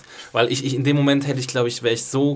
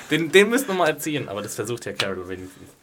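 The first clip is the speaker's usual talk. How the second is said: normal talking voice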